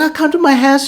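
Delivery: high-pitched mock flirty invitation; teasing come-on. Judy coming on to the player, mock teasing. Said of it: "like, toying".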